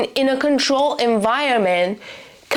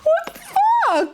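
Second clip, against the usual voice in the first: much higher, slow outraged gasp; shocked disbelief; slower than usual. Real, not acted. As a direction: stretch it out, horrified. Reacting to the book blurb.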